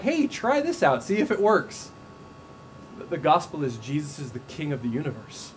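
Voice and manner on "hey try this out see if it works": light and high, like pitching a product; breezy salesman pitch. Retold or imitated. Preacher mocking faith as mere advice, breezy and high. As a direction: sell it like a casual tip. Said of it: "It's not like".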